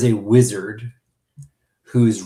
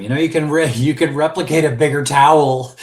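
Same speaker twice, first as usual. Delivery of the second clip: mock yell, a bit higher and louder; Mariner yelling; 3 semitones higher, 6 dB louder than usual. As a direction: toss off a mild imitated yell at the guy in the towel. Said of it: "Mariner yells at him".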